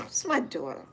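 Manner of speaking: low, soft, disgusted mutter; an old mother's quiet disdain at her daughter. Retold or imitated. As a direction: say it like the idea repels you. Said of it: "Just like that".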